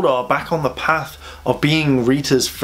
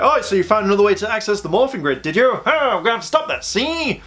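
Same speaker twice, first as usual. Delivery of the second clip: higher, quick sneering villain voice; mock villain taunt; 7 semitones higher than usual. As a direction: toss it off like a smug cartoon baddie.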